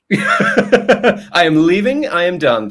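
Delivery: high, loud, drawn-out mock protest; mock indignation. Host mimicking his client's refusal, mock indignation. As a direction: play a hammed-up walk-out.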